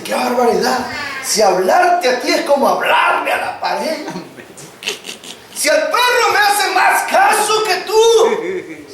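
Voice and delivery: put-on fed-up parent yelling at the teen; exasperated parent. Parent scolding ignoring teen.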